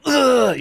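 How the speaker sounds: little grunt. Retold or imitated. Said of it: "give a little".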